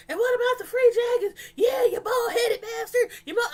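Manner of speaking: imitating the girlfriend screaming insults, laughing; mocking Donna shouting. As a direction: shriek it like you're losing it, half laughing. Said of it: "She's up there".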